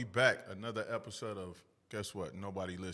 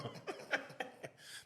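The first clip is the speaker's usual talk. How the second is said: laugh; 7 dB quieter than usual. Real, not acted. Laughing at unsexy joke.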